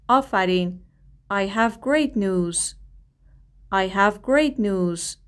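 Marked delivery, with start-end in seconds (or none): 1.3-5.2 slow and careful, leaning on "great"; slow model reading. Shown as a demonstration. Teacher modelling a phrase slowly for students to repeat. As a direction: say it slowly for the class to echo.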